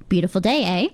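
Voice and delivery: eh tipped up lightly at the end; a chatty remark closed with an upturned eh; rhetorical eh. Shown as a demonstration. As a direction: toss off a rhetorical eh. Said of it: "A could also be rhetorical".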